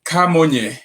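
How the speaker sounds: Camonye said kah-MOH-nyeh with a falling end; name pronunciation. Shown as a demonstration. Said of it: "how do you actually pronounce your last name".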